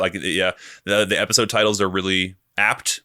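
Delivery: ordinary talk, nothing marked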